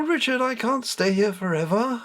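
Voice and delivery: high, imperious goddess voice; goddess Bastet, commanding. Acted. Goddess Bastet speaking to boy.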